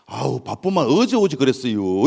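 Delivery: slow, low Chungcheong retort; dry, dragging Chungcheong comeback; Chungcheong countrywoman. Acted. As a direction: drawl it out with Chungcheong unhurriedness. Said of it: "충청도 있는 그 양반이 그러잖아".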